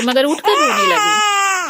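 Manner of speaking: put-on high drawn-out baby talk; high, slow sing-song for a baby. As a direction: coo slowly at the crying baby. Cooing to crying baby.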